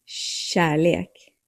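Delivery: "Kärlek" starting with a soft sh. Shown as a demonstration. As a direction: say "Kärlek" as shah-lek. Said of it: "Soft K".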